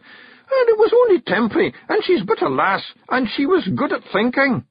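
very high, loud gabbling; panicked excuses. Acted. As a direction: babble to escape.

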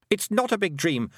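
ordinary delivery, nothing marked

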